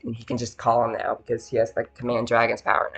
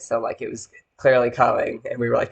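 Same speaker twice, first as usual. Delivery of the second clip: ordinary talk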